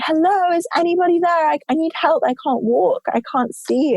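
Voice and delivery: high, panicked mock call for help; retold scream for help. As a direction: call out from the dark, pleading for help.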